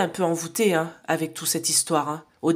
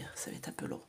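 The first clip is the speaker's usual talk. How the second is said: soft whisper, trailing off; 15 dB quieter, faster than usual. Real, not acted. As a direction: let the verdict sink to a whisper. Whispers reading of the cards. (chuchoté, en s'éteignant)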